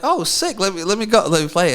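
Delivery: high, loud, rushing to grab it; eager excitement. Retold or imitated. Guest retelling his eagerness to play the guitar, excited.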